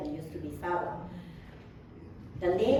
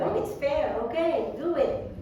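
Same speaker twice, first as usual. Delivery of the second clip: higher-pitched, mock easy acceptance; ready agreement; 7 semitones higher than usual. Second woman accepting Solomon's ruling, mock ready agreement. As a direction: lightly act out a careless yes.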